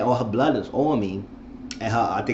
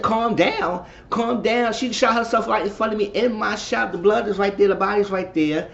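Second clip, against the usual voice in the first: much higher, indignant voice in mock disbelief; indignant outburst; 10 semitones higher than usual. Telling Pam off for saying calm down, high indignant voice. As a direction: throw it back at her, outraged and high. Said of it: "I said".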